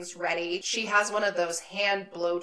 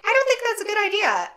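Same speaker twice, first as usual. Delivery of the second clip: high, fast, fretful mom voice; worried mom; 12 semitones higher, 5 dB louder, faster than usual. Retold or imitated. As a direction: fuss like an anxious mother. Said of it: "she's like".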